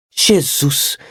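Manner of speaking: put-on high, anxious old servant voice; a fretting elderly housekeeper asking; old maid. A narrator's reading. Marta asking the nephew about dinner, high anxious old servant. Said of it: "Marta despairs".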